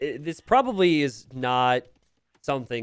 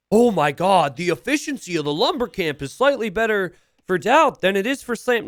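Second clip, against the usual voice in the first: mock dramatic gasp; 6 semitones higher, 6 dB louder than usual. Mocking an exaggerated chat take.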